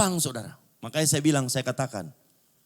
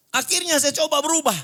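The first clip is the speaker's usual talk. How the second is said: high, forceful declaration; loud preaching; 6 dB louder than usual. Real, not acted. Preacher testifying that he tried to change, loud preaching. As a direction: proclaim it loudly, pitched well up. Preacher surges at turning point.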